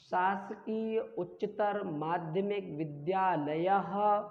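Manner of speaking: "शासकीय उच्चतर माध्यमिक विद्यालयः" slow and measured; slow dictation of "शासकीय उच्चतर माध्यमिक विद्यालयः"; pronunciation demo. Shown as a demonstration. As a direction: end "विद्यालयः" on a sounded "ha". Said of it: "विसर्ग का उच्चारण जरूर करिए".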